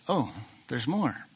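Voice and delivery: soft and slow, deflated; dismayed discovery. Retold or imitated. Peter finds the back page of the exam, quietly dismayed. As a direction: let it sink in quietly, a bit let down. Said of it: "turns back to the back page and goes".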